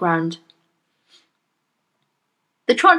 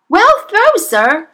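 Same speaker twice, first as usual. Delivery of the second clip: high, loud cheering call; distant-spectator shout; 11 semitones higher, 7 dB louder, slower than usual. A narrator's reading. Someone applauding the throw from across the playground, shouting. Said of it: "someone shouted from across the playground".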